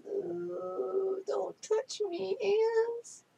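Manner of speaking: high, slow disgusted squeal; squeamish squeal. Acted. Squeamish about ants crawling.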